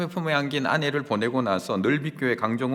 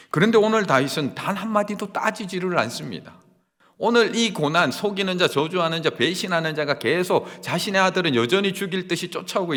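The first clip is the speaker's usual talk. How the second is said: louder preaching that drives each line; impassioned preaching; 5 semitones higher, 5 dB louder than usual. Acted. Preacher stressing David's silence to the congregation, with impassioned force. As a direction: build the point with force.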